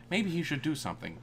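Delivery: ordinary delivery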